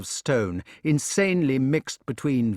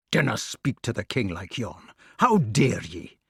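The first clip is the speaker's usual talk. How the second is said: Scots rebuke. Acted. Gawain rebukes his brother.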